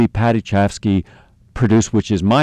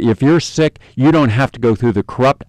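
ordinary delivery

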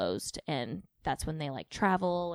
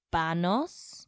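Banos with a Mexican accent. Shown as a demonstration. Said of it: "with a Mexican accent".

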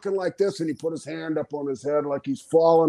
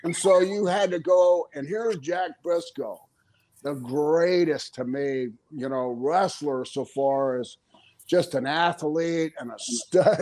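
ordinary talk, nothing marked

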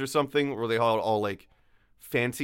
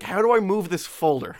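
laughing; 4 semitones higher than usual. Retold or imitated. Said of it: "I was just like".

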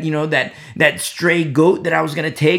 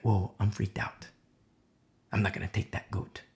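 low, soft, shaken murmur; a would-be thief scared off, hushed; spooked hush; 7 semitones lower, 9 dB quieter than usual. Acted. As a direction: drop low and quiet, rattled. Frightened resolve after seeing corpse.

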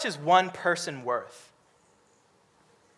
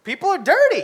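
higher, louder mock complaint; mock disgusted host; 10 semitones higher, 8 dB louder than usual. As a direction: act out the fussy homeowner. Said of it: "I have this saying".

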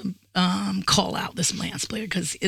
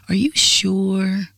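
slow, drawn-out doubting question; condescending parent tone; much slower than usual. Retold or imitated. Friend doubting her loan request, condescending. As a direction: stretch it out as if to a child. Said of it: "he said it in that tone".

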